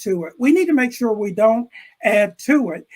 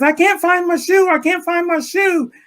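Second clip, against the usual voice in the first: put-on frantic kid; 10 semitones higher, 5 dB louder than usual. Kid panicking about lost shoe.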